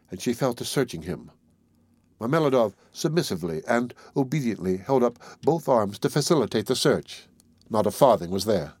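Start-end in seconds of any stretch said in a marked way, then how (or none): none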